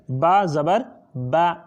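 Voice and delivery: با زبر spelled slowly, then با clipped short; slow spelling then short ba. Shown as a demonstration. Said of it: "اس کو جلدی پڑھتے ہیں". (ہجا کر کے با کو جلدی اور مختصر)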